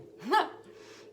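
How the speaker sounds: laugh. Real, not acted. Laughs at too many messages.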